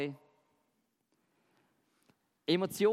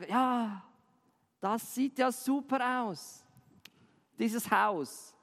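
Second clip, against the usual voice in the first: higher, slow exaggerated praise of a child's drawing; ironic praise; 4 semitones higher, much slower than usual. Adult to child about a drawing, mock-enthusiastic ironic praise. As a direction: gush over it with obvious irony. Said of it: "Das nennt sich Ironie".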